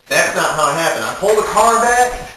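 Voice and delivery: normal talking voice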